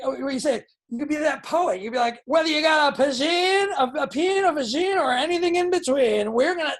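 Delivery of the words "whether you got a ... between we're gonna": loud, high, pushy sales pitch; car salesman pitch. Pretend. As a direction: sell it like a car salesman on the lot. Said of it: "it sounds like a car salesman".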